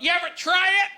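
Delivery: high-pitched mock yell; retold yell. Comic imitating a woman yelling out at him, mock yell. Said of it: "Then she yelled out".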